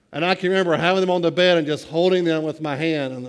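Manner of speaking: ordinary talk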